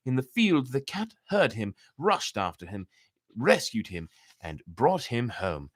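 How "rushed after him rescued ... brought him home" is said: plain reading voice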